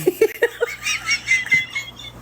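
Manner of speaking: very high squealing laugh. Real, not acted. Cracking up at comments, high squealing laugh. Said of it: "I can't stop laughing".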